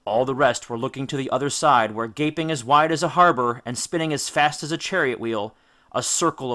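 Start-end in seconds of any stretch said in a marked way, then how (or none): none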